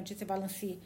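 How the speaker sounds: usual speaking voice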